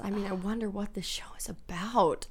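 whispered sarcastic aside. Real, not acted. Sarcastic aside about show.

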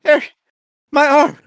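high, loud, panicked imitation of his friend; mock panicked friend. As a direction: squeals it like a frightened kid. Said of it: "and you're like".

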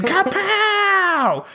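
high, sharp kapow impact noise; cartoon punch sound; kapow sound effect. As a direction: mimic a bone-cracking hit. Mimicking the chiropractor's blow again.

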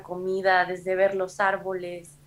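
ordinary delivery

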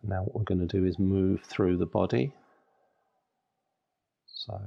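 ordinary delivery

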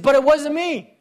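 put-on high, loud kid's protest with a laugh; retold child protesting the foul. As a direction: play the wronged little boy, laughing. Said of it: "I said".

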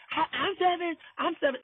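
high, childish mock of seven year old. Mocking a childish coworker in a high little-kid voice.

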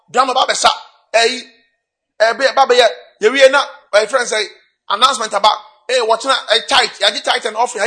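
high, loud, driving list; forceful preaching. Real, not acted. Preacher listing what crowds out God's word in church, forceful. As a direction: pile up each item loudly, pitched high.

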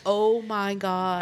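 drawn-out stunned reaction; slow stunned oh my god. Retold or imitated. Realizing the mix-up, slow stunned reaction. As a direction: stretch it slowly in disbelief. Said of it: "And I'm like".

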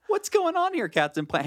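echoing the high indignant squeal; high, outraged cartoon complaint; mock outraged cry. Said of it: "echoing the bit".

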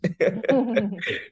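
a short, high burst of laughter. Real, not acted. Amused at her own Mexican saying, high laugh.

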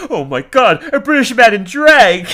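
high, loud cartoon shock; hammed-up astonished yell breaking toward laughter; shocked outburst. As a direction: cry it out in mock horror, building. Acting out Homer's shocked outburst.

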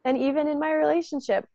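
ordinary talk

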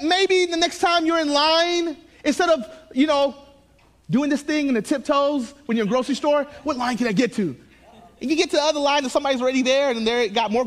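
animated storytelling. Acted. Excited sermon illustration, raised voice.

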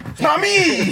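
high, loud protest of innocence; hammed-up callback shout; mock yelled denial. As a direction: yell it like a caught schoolkid.

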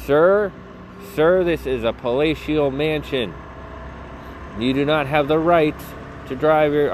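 indignant yelling. Real, not acted. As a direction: call out to the truck driver in mock outrage. Yelling at truck driver.

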